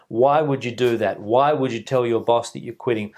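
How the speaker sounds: ordinary talk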